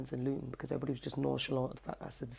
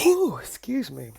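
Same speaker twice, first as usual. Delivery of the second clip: yawn; 7 semitones higher, 13 dB louder, slower than usual. Real, not acted. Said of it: "I'm always yawning".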